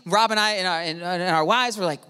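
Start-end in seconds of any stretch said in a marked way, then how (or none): none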